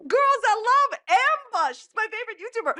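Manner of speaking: fake-sweet fan praise, way up high; mock gushing. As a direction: gush sarcastically in a sugary high voice.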